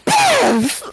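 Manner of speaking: very high, embarrassed shriek of a name; mortified outcry. Acted. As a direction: yelp it in embarrassed shock. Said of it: "Emily goes pale in the face".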